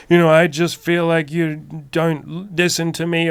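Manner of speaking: high, loud, slow whiny complaint; imitating a girlfriend's tearful complaint; mock crying girlfriend. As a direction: play the upset girlfriend, pleading and teary. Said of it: "she can just be, like, crying and being like".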